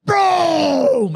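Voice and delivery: loud high shout of boom; yelled boom. Retold or imitated. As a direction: drill sergeant bursting in, shouting. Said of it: "and goes".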